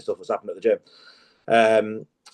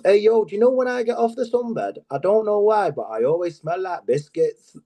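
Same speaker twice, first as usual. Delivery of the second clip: much higher bro voice, slowing at the end; sauna gym lad; 9 semitones higher than usual. Retold or imitated. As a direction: play the dim gym lad on the sunbed. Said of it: "I do all the voices".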